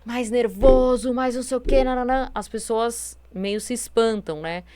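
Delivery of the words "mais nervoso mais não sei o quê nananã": nervous, irritated tone. Shown as a demonstration. Speaker shows listeners how talking in a nervous tone sounds, mock irritated. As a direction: stretch the words with mock irritation. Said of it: "a gente fala num tom mais nervoso".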